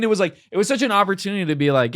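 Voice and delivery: ordinary talk, nothing marked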